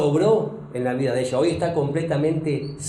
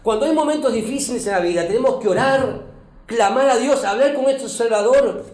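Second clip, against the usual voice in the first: fervent preaching; 4 semitones higher than usual. Acted. Pastor urging the church to pray in hard times, fervent. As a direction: drive it home to the congregation. Preaching builds to full force.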